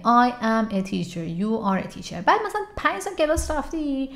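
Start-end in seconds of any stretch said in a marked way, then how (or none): 0.0-2.1 slow beginner class delivery; slow classroom English. Retold or imitated. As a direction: say it slowly like a beginner.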